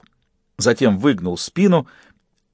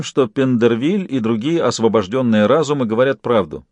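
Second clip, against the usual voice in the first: usual speaking voice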